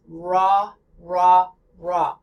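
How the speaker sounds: ra with an American R. Shown as a demonstration. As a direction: say ra with a tongue-curled English R. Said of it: "the American R is".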